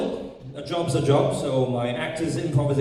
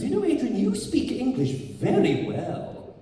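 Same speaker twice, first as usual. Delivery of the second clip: higher, slow patronising director voice; English director; 7 semitones higher, slower than usual. Retold or imitated. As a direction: compliment him slowly, talking down to him. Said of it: "told by these, uh, English directors".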